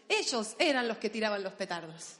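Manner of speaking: usual speaking voice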